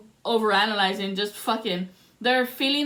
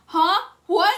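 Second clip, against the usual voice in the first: louder, startled huh of feigned confusion; imitating the clerk's blank reply; mock clueless; 7 semitones higher, 5 dB louder than usual. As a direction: play dumb, caught off guard.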